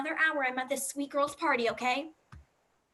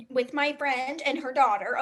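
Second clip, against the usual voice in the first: normal talking voice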